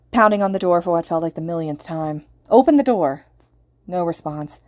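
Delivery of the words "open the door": loud, high shout. A narrator's reading. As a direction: bang on the door and shout. Pounding on door, shouting.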